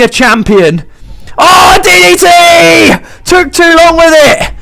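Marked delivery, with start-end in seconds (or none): 1.4-4.5 higher, urgent play-by-play; sudden burst of excitement; excited commentator. Real, not acted. Sudden comeback moves.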